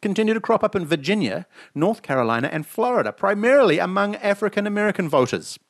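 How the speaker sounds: lightly newsy read-aloud; news read. Acted. Host reading the poll story to cohost, lightly newsy. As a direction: read the headline story like a bulletin.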